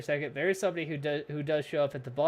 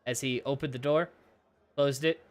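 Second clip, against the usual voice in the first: scene-setting narration; 4 semitones lower than usual. A narrator's reading. Game master opens the scene in hell, low measured narration.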